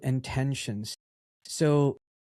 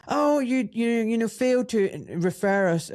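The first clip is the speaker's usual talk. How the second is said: high, halting mock official; stumbling high-pitched reproach; imitated accusing director; 9 semitones higher, 3 dB louder than usual. Director's accusing reply, acted.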